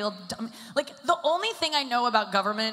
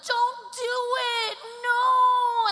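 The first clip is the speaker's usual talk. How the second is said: much higher, louder crying no; friends' pleading cry; 6 dB louder, much slower than usual. Retold or imitated. Friends begging her to skip the Botox, high loud pleading cry. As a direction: beg her not to do it. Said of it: "all my friends were like".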